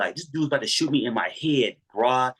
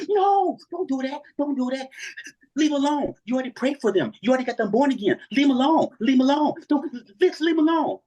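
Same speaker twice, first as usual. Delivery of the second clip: mock hysterical wife; panicked wife; 13 semitones higher than usual. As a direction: beg him to drop it, in a panic.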